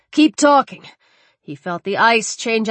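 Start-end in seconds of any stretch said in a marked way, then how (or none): 0.1-0.8 calling out. Acted. Calling out across ice.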